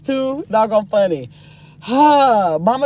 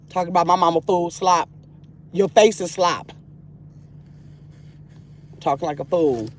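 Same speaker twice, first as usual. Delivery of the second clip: low annoyed; 6 semitones lower, 3 dB quieter than usual. Real, not acted. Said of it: "They got me mad".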